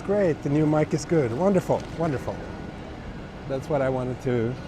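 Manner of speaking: usual speaking voice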